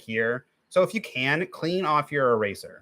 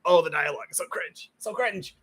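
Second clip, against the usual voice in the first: mock cringing reaction; 7 semitones higher than usual. Viewer reacting to a clumsy heartwarming scene, mock exasperation. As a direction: roll your eyes at bad dialogue. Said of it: "and it's like".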